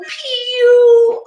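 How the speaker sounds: high loud stinky pew; big pew. Shown as a demonstration. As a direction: hold your nose and squeal. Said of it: "give me a big pew".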